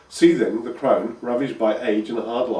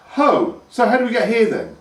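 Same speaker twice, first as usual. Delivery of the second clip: higher and louder, eager; lightly voiced curious boy; 11 semitones higher, 6 dB louder than usual. A narrator's reading. Pike pressing his mother about fish. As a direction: ask it pushing the point.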